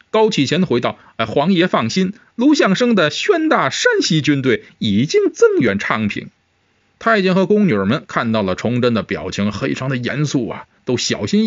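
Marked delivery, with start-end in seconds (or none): 1.2-6.3 higher-pitched eunuch voice; high-voiced courtier; reassuring eunuch. A narrator's reading. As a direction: reassure your master in a raised, eager servant's pitch.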